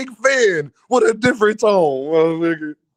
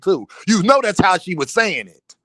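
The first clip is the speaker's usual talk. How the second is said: ordinary delivery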